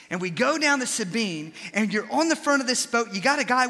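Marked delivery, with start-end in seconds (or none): none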